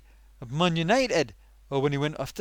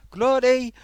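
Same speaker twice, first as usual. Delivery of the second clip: loud exclamation; 8 semitones higher, 9 dB louder than usual. Acted. Puppet character exclaims.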